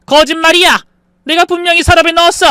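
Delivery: high, loud, fast outburst; angry shout. Acted. Mother shouting at son over bankbook lie, angry.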